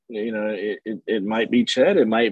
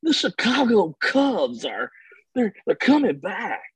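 Harry Caray; 12 semitones higher than usual. Retold or imitated. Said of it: "you almost say it like, uh, like Harry Carey".

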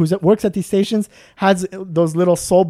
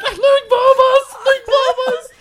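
much higher, slow, laughing yell of Ningbo bus; mock scream; 3 dB louder, slower than usual. Worker screaming outside the washroom, high laughing yell. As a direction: holler it from outside the door, cracking up. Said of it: "hear him screaming from outside".

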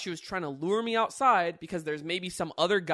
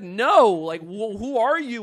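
loud, higher demand to a stranger; retold emphatic refusal; 5 semitones higher, 13 dB louder, slower than usual. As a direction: push back hard, raising the voice.

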